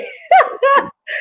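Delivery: squealing high laugh; a giddy shriek of laughter. Real, not acted. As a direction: burst into a delighted high laugh. Host laughing at song.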